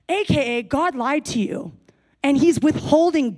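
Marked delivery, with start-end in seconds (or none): none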